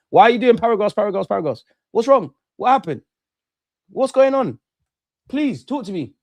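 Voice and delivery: high, loud teasing questions; mock concern. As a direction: pester them with exaggerated concern. Mock concern at upset chatters.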